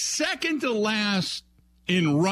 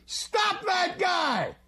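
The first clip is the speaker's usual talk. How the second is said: higher, slow stretched yell; higher stretched mock cry for help; 10 semitones higher, much slower than usual. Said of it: "he yells to his defense".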